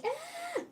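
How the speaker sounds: high-pitched imitated kid scream. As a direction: squeal like kids clinging on. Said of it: "they're like".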